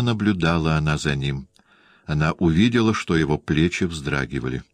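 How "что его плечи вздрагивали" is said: ordinary delivery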